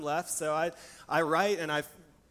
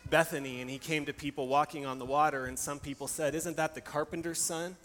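hushed scripture storytelling; 2 semitones lower than usual. A narrator's reading. Retells the gospel story hushed.

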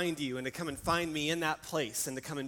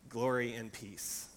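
hushed scripture storytelling; 2 semitones lower than usual. A narrator's reading. Retells the gospel story hushed.

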